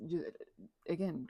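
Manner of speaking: ordinary delivery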